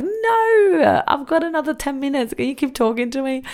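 high-pitched pleading; pleading to stay longer. Retold or imitated. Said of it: "I'm like".

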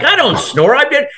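high-pitched protest, shocked at the accusation; mock-outraged I don't snore; indignant denial. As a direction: deny it, high and offended. Denying wife's snoring claim.

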